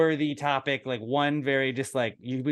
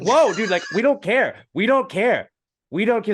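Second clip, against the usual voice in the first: higher and louder, waving it off; raised mock protest; 8 semitones higher, 7 dB louder than usual. To the ranting fan, raised and insistent. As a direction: push back hard, half laughing. Retelling pushback to homophobic rant.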